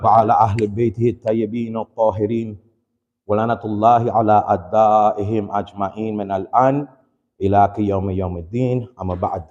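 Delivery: quick, low, murmured Arabic opening blessing; formal opening invocation. Acted. Preacher opens the sermon with the ritual blessing, quick and low. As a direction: rattle off the opening prayer formula.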